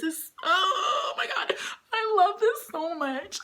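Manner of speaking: high, breathy gushing over the photo; fangirl squeal. Real, not acted. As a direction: gasp out love for him.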